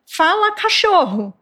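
high, commanding tone on friendly words; same scolding tone. Shown as a demonstration. Explaining that children read tone, the greeting said in a scolding voice. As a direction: say a friendly phrase the way you would scold. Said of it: "Do mesmo jeito que eu tô falando".

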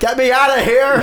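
high, loud, drawn-out plea; exaggerated yell to get out; mock desperate cry. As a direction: cry out to escape, hammed up. Retelling wanting out of the car.

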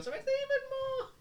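squeaky voice. Acted. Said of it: "and it's female".

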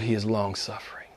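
a whispered testimony of grace; hushed whisper. Real, not acted. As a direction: drop to a near whisper. Praise after testimony, hushed.

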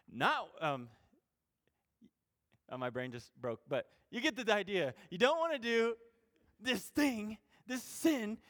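ordinary delivery

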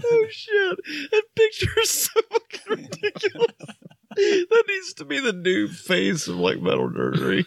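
high-pitched helpless laughter; can't stop laughing; laughing hard. Real, not acted. Uncontrollable laughter at a photo.

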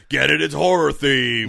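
loud hype. Acted. Loud ad aside.

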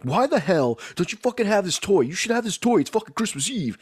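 high-pitched, indignant customer rant; mock angry confrontation. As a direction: pretend to chew out a store clerk. Imagined confrontation with a store worker.

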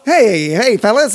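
hammed-up 1930s movie cop greeting; 1930s beat cop. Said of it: "why they all talk like this in the movies".

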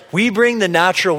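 usual speaking voice

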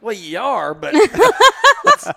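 laughing. Retold or imitated. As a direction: tease her through laughter. Teasing wife while laughing.

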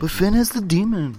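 Irish accent, half-hearted and hammed-up; Finn Balor impression. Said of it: "I was going to do a Finn Balor impression".

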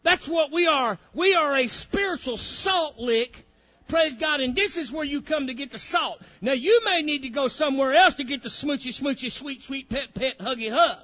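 loud, ringing pulpit preaching with high pitch; preaching at full force. Acted. Preacher rousing his congregation, loud full-force preaching. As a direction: preach it out to the whole church.

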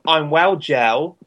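slow, drawn-out Essex slang; hammed-up Essex geezer greeting; Essex accent. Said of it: "as they say in, um, English Essex slang".

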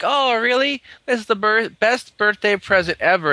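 high-pitched imitation of delight; mock excitement. Recapping the heroine's reply about her birthday present, mock-excited.

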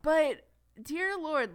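drawn-out exasperated "dear Lord"; slow, high, fed-up groan of a phrase. Real, not acted. Exasperated outburst about Caitlin.

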